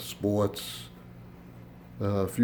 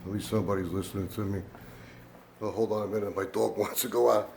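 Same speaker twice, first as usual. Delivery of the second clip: normal talking voice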